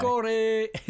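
put-on quick Bolton accent line. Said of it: "doing a Bolton accent there".

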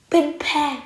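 ordinary delivery

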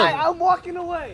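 high, half-shouted laughing exit; giggly high getaway call. Real, not acted. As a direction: shout it while bailing out. Leaving during the rant.

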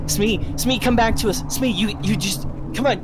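high, pleading call to rouse someone; urgent calling back. Acted. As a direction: call desperately to an unconscious friend. Companion calling Smee back to consciousness.

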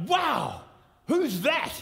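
high amazed exclamation; surprised wow. Retold or imitated. Speaker seeing himself on video, high amazed wow. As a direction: react in disbelief at your own image. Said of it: "I was like".

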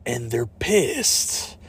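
very slow, stretched-out voice-over; trailer announcer tagline. Acted. Closing tagline of the fake dinosaur trailer, mock-ominous. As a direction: draw it out ominously.

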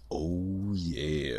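a hint of smooth soul-singer voice, playfully savored. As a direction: show off a congested bass voice, softly.

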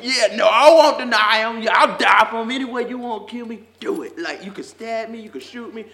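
mock boastful believer. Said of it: "so many people like".

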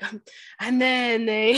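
mock voice, slow and pitched slightly up; put-on voice, dragging the words out; that voice of his. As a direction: imitate his drawn-out storytelling voice. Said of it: "he always does that, that voice".

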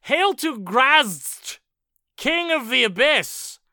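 loud, slow hailing of a demon king; mock demon chorus hailing their king; demon chorus cheer. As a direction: proclaim it like a chanting horde. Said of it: "the first sentence is".

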